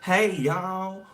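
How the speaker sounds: very high, hammed-up country greeting; exaggerated country accent, high and drawn out. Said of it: "a country-ass accent".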